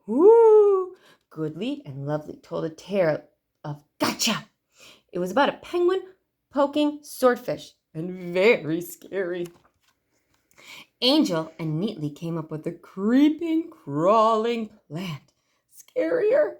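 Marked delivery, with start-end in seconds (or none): 0.1-0.9 ooh. A narrator's reading. Scary story time exclaim. 15.8-16.6 scarier. A narrator's reading. Narrator hamming up scary.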